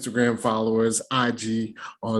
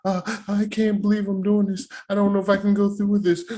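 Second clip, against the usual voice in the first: mocking hesitant bride; 7 semitones higher, faster than usual. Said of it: "playing up the whole trope".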